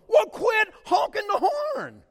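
high, slow, loud yell cracking with laughter; wife's shout through laughter. Retold or imitated. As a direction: snap back while laughing. Wife yells, laughing.

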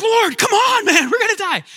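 loud, high panicked plea; panicked cry for help. Retold or imitated. Disciples crying out to Jesus in the storm, loud panic. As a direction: shout it like sailors sure they'll drown.

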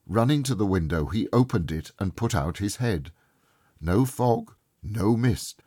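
plain reading voice

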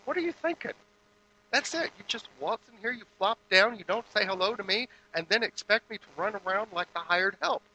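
mock furious wife, pitch soaring; wife losing it. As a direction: let the fed-up wife explode. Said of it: "the wife at this point kinda loses it".

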